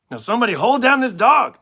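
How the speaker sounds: high, loud cartoon yell; mock urgent command. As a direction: call out orders to the gang. Acted cartoon gang leader giving orders.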